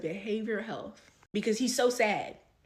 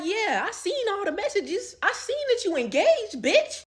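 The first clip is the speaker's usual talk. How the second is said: retold confrontation; 10 semitones higher than usual. Confronting the boyfriend about his engagement, high accusing voice. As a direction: throw it in his face. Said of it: "I'm like".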